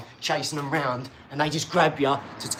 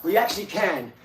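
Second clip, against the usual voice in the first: ordinary talk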